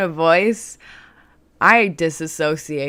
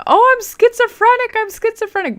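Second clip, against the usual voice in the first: mock panic; 6 dB louder than usual. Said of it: "trying to not be like".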